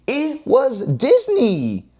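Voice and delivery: high, slow, stretched-out DISNEY; mock disbelief. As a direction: drag out Disney in pretend shock.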